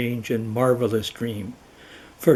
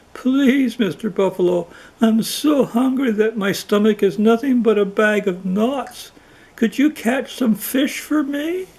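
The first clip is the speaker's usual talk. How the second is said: high, pleading and louder; begging; 10 semitones higher, 3 dB louder than usual. Acted. Starving lion begs the buffalo for fish, high-pitched pleading. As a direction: beg the buffalo pitifully. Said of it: "who was begging".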